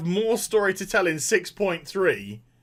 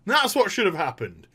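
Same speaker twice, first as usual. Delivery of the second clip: usual speaking voice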